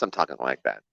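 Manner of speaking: very low, measured assistant voice; low, formal, unfamiliar register; impersonal guide voice. Shown as a demonstration. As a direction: drop deep and stay detached. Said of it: "it'd be weird to talk like this".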